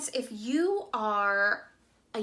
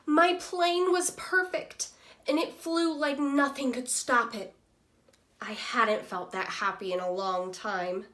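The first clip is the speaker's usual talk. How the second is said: lively storybook reading voice for a class; reading to children. Acted. Teacher reading Humpty Dumpty's story to students, lively storytelling voice. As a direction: read it like a picture book to young kids. Teacher reads picture book aloud.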